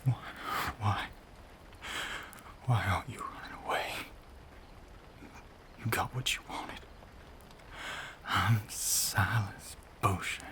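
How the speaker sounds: breathy, halting self-condemnation; anguished confession. Acted. Anguished confession after giving in.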